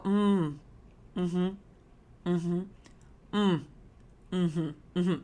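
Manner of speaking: soft mock mm-hmm noises; imitating listening hums. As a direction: hum like a bored Zoom listener. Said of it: "hear you go".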